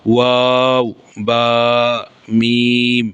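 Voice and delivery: মিম, বা, ওয়াও each held long, lips closed then rounded; Arabic lip letters. Shown as a demonstration. Teacher drilling the lip letters for students, drawn out. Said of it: "এই তিনটি হরফ দুই ঠোঁট হইতে".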